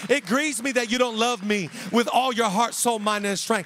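pleading, growing to a loud plea; grieved Holy Spirit. Acted. The Holy Spirit tells the believer what grieves it, rising to a loud plea. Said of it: "Holy Spirit to say".

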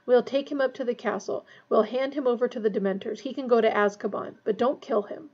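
ordinary delivery, nothing marked

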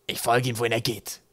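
loyal sidekick's grumbled vow; gruff rumble. A narrator's reading. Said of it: "brummte der getraue Grog".

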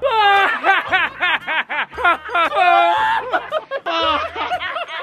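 long villain cackle; evil cackle. Acted. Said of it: "practicar nuestra risa malvada".

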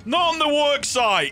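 high, loud, slow telling-off; mock foreman scolding. Site boss scolding his worker, hammed-up angry shout. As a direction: lay down the site rules like a cross boss.

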